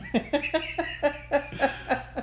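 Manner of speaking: real cackle at the absurd story; bright laughing fit. Real, not acted. Laughing at the guys' argument.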